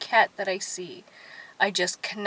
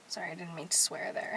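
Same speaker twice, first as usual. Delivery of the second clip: quick whispered apology; fast sheepish whisper; 4 semitones lower, 9 dB quieter, faster than usual. Real, not acted. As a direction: whisper it fast, a little guilty. Apologizing for swearing.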